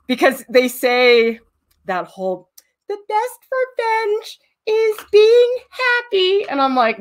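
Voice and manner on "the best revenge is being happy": mock saying. Mocking a saying she rejects.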